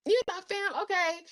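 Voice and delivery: surprised self. Retold or imitated. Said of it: "I'm like".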